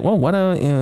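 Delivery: ordinary talk, nothing marked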